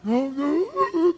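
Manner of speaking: high, hammed-up sobbing wail; imitating a crying victim; freaking out. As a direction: sob it out in mock panic. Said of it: "He's freaking out of his mind".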